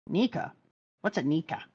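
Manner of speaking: quiet mock Luffy. Voicing Luffy in the scene.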